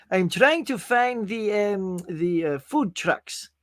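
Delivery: slow, tentative imitation of a producer's accent; Ilya Salkind's accent. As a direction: take a drawn-out stab at his foreign accent. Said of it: "Not even sure if that's his accent".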